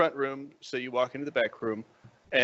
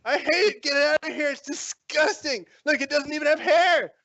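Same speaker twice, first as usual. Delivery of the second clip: high, screechy tirade; disgusted ranting; 11 semitones higher, 4 dB louder than usual. Acted. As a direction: rail in revulsion, stretching the insult. Girlfriend rants in disgust at gift.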